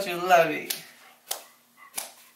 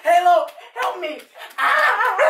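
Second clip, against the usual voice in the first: mock screams; 8 semitones higher, 4 dB louder, much slower than usual. Pretend attacked, calls dog.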